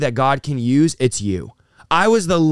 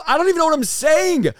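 high-pitched disbelief; 13 semitones higher than usual. Real, not acted. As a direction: pitch jumps way up. Recalling his wild promises.

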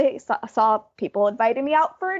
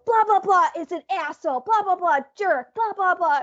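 mock yelling rant; 6 semitones higher than usual. Manager ranting over the insulting email, mock yell. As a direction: rattle off his rant like a scolding. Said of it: "he's yelling at me".

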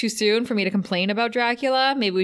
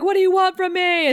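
movie seeker's plea; 11 semitones higher, 5 dB louder than usual. Retold or imitated. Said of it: "it's always like".

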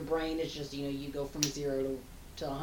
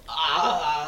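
startled gasp; 8 dB louder than usual. Retold or imitated. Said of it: "your response is to go".

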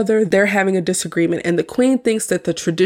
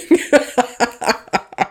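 amused, trailing run of laughs; real laughter; 4 semitones higher than usual. Real, not acted. Host amused that renouncing means nothing, laughing. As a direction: giggle on after the joke.

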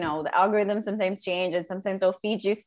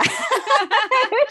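loud, much higher cackle of a laugh; 7 dB louder than usual. Real, not acted. Laughing because her sister will hear. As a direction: burst of high laughter.

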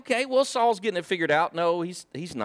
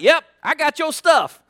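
cocky reply; 7 semitones higher, 5 dB louder than usual. Retold or imitated. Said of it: "David's like".

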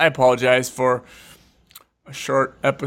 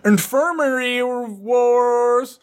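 goofy mock title; 9 semitones higher, much slower than usual. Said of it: "something stupid".

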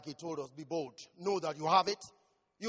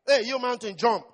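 commanding shout; 5 semitones higher, 14 dB louder than usual. Acted. Preacher ordering the mountain to move, full shout.

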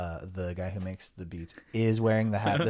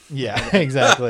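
laughing; 4 semitones higher, 11 dB louder, slower than usual. Real, not acted. Laughing agreement.

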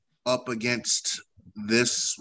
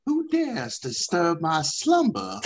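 grinding stone statue; 8 semitones higher than usual. Acted. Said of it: "Yalar comes out of his shell".

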